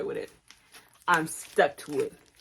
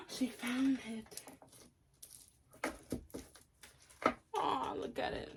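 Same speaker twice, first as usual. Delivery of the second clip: cooing; 7 semitones higher, 11 dB quieter, slower than usual. Real, not acted. Cooing at the bee.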